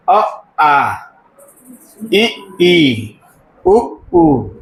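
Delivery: each short vowel followed by its drawn-out long one; अ, इ, उ brief, आ, ई, ऊ held long; schoolroom vowel recital. Shown as a demonstration. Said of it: "ऐसे पढ़ेंगे स्वर को".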